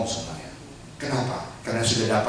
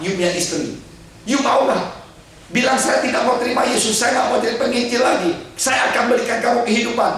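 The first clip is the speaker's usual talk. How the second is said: higher, louder, fast persuasion; tempting chief; 12 semitones higher, 5 dB louder than usual. Acted. Village chief tempts the evangelist with his wife's life, fast and pushy. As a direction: press and tempt him to give up.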